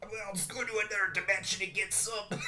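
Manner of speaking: huffy slurred threat with a laugh behind it; Rick. Pretend. Imagining the scientist refused sauce at McDonald's, mock-huffy Rick voice. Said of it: "Rick would have blew up the McDonald's".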